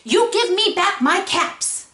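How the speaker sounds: high, loud storybook demand; scolding. Acted. Peddler demands his caps from monkeys, scolding. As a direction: demand it back, wagging a finger. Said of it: "shaking a finger at him".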